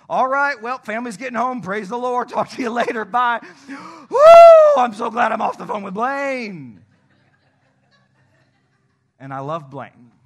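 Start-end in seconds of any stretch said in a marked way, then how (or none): 0.1-3.4 loud, high-pitched mock-cheery goodbye; awkward sign-off. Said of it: "a very awkward conversation altogether". 3.7-6.8 loud, high whoop of mock relief; exaggerated relief. Acted relief after hanging up.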